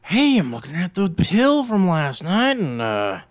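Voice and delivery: high, slow, scratchy manager voice; Richard on the phone. Retold or imitated. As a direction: needle them about a missing receipt. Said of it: "He would always call you".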